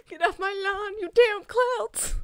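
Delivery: hammed-up old-man yell, high and slowing down; grumpy old man. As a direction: play the cranky old man through laughter, stretching the end. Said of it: "imagining it with clouds instead of kids".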